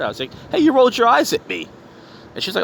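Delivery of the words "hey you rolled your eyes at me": retold confrontation, high and loud; calling her out. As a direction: raise pitch and volume, imitating an indignant call-out. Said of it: "I called her out".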